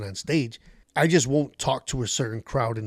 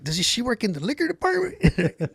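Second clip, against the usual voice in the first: laughing jab; 8 semitones higher than usual. Retold or imitated. Joke at heckler's expense.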